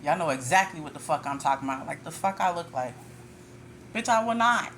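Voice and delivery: ordinary talk, nothing marked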